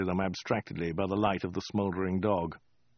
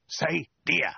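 higher, insistent order; hissed prompt; 8 semitones higher than usual. A narrator's reading. Soldier prompting the woman, insistent hiss. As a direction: push both words out sharply. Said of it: "hissed the soldier".

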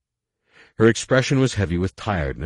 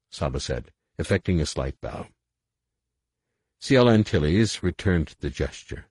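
ordinary delivery, nothing marked

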